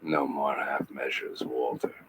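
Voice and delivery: deep hushed delivery, held back; low, quiet line reading. Shown as a demonstration. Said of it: "we did the scene and I went".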